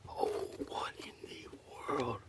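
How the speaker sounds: hushed, breathy what in the world; barely voiced, stunned by the find; amazed whisper. Real, not acted. Whispering to viewers over a strange stone, amazed.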